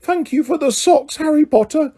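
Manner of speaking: high, forlorn elf voice calling out; Dobby calling sadly after Harry; miserable elf call. A narrator's reading. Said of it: "Dobby called miserably".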